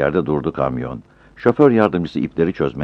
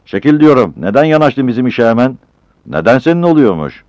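loud and higher, squabbling; angry quarrel; 9 semitones higher, 7 dB louder than usual. Acted. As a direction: fight over the crate. Two porters quarrel. (öfkeyle atışma)

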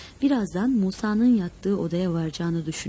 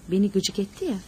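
ordinary delivery, nothing marked

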